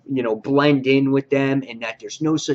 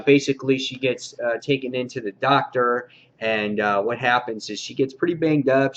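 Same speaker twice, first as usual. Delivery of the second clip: normal talking voice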